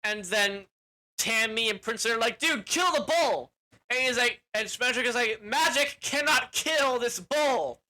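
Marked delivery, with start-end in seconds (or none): 2.4-3.5 hammed-up battle cry; mock urgent shout. Heroes yelling at wizard. 5.4-7.8 mock grand declaration. Wizard declares solemnly.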